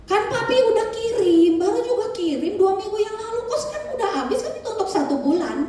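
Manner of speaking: high, laughing mock scolding of a child; a father's amused high complaint. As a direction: tease the kid about spending the money. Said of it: "Terus bapaknya bilang".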